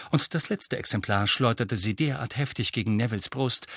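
normal talking voice

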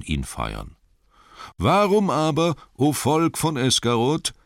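flatter, then rise to the crowd; Master flattering. Acted. Master praises Bard to win the townsfolk, rising oratory. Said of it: "der Bürgermeister".

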